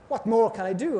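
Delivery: normal talking voice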